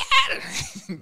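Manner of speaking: loud high laugh; burst of laughter. Real, not acted. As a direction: let a big laugh burst out. Laughing at recalled scene.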